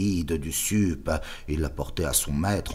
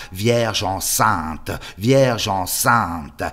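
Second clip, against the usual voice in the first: "Vierge enceinte" roared louder, slowly, with scorn; bursting out in mockery; 4 semitones higher, 7 dB louder, much slower than usual. The superior bursting out at the poem's fifth line, scornful. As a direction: explode on "Vierge" twice, horrified and mocking. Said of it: "il éclata".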